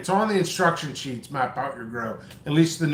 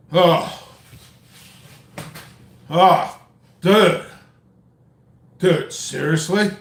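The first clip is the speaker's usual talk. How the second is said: Brad Garrett impression; 3 dB louder than usual. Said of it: "It's almost like Brad Garrett".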